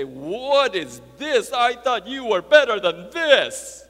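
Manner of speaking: loud, high, exaggerated rebuke; Jesus, scolding. Imagined rebuke of a doubting John, mock outraged scolding. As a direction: shout it as a drawn-out telling-off. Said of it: "would respond kinda like that".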